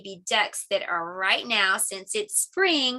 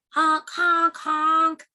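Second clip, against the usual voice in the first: high, loud goose honks; goose honking; 6 semitones higher, 4 dB louder, much slower than usual. Acted. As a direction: honk three times like a goose. Story's goose answers.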